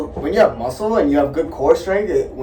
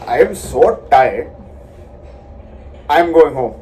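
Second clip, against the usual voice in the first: Indian accent slipping into Canadian; 2 semitones lower, 3 dB louder than usual. Shown as a demonstration. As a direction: try an Indian accent word by word, Canadian showing through. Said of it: "this is Canadian accent".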